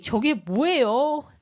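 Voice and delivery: lightly voiced child asking, drawn out; curious child. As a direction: ask slowly, imitating a pupil a little. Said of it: "아이들이". (궁금해하는 아이를 살짝 흉내 내며)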